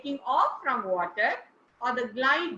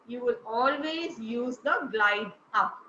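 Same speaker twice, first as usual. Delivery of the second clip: ordinary delivery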